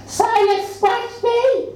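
shrieking. A narrator's reading. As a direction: wail it like a spoilt child. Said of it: "shrieks horrible Doris".

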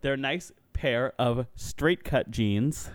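slow, each word spaced out; slow careful retry. Shown as a demonstration. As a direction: say it slowly so it gets through. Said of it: "I'm gonna try it one more time".

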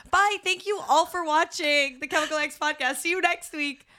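high-pitched podcast goodbye; chirpy sign-off. Retold or imitated. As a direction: sing out a mock cheery farewell. Re-enacts her own podcast sign-off.

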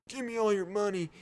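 robber demand. Acted.

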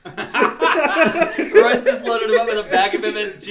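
loud, drawn-out laughing delivery; helpless laughter through the words. Real, not acted. Bursts out laughing at the story.

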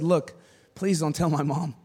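soft, low pleading; quiet plea. Retold or imitated. Teen to homeowner, meek soft plea. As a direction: beg meekly.

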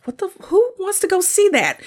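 high-pitched disbelieving reaction; retold "who does that?" incredulity; incredulous. Reacting in disbelief to teens' choice.

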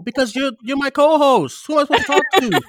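loud, high-pitched protest; exasperated. Real, not acted. Host defends himself to his co-host, exasperated.

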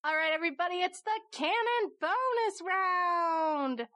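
softly announced bonus round with sung jingle; host the bonus round playfully; game show host. Acted.

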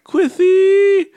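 high, loud lisped call; lisping impression. Friend mimicking her calling out in Japan, shrill lisping impression. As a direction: squeal the name in mock baby talk. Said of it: "Why does it sound so weird".

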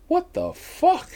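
slow, high, stretched what the fuck; stunned. Real, not acted. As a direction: drag it out in disbelief. Seeing the vial of blood.

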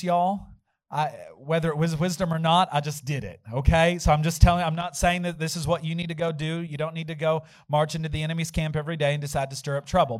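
ordinary talk, nothing marked

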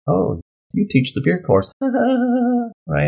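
mock them with a high, teasing lilt; snide mock amusement. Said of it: "kinda snide look".

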